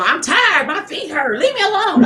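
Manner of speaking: high, irritable mock grumbling; grumpy complaint. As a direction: snap it out like a worn-out mom after work. Grumpy mom coming home.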